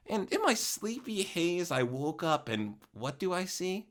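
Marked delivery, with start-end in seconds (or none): none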